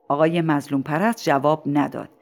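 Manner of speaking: ordinary delivery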